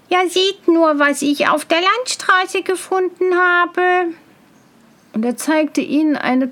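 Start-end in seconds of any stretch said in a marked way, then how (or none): 0.1-4.1 high, eager bumpkin voice; excited simpleton. A narrator's reading. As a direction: show off your find, high and proud. Tölpelhans shows off his find.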